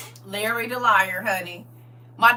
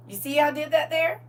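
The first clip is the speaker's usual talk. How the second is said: normal talking voice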